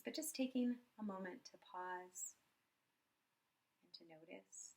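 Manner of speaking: soft, unhurried meditation voice with long pauses; guided meditation. Acted. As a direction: guide them gently through a body scan, quietly. Leads a body scan meditation.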